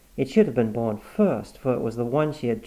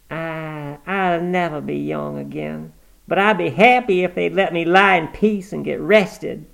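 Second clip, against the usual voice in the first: put-on higher, louder peevish old mother; cranky old woman; 6 semitones higher, 5 dB louder than usual. Granny complaining about her face being washed, peevish. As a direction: grumble like a stubborn invalid.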